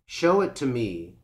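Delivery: usual speaking voice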